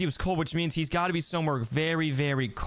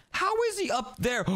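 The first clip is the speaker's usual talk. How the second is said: surprised; 13 semitones higher than usual. Real, not acted. Spots brother on roof.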